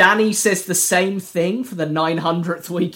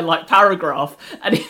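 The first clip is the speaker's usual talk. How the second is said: ordinary delivery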